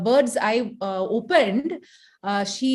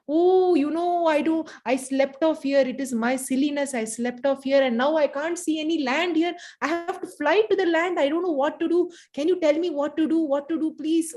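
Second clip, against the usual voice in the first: lightly voiced, higher, pleading bird; a touch of a helpless bird begging the rat; storyteller's mild voice for a stranded, pleading bird; pleading little bird; 6 semitones higher than usual.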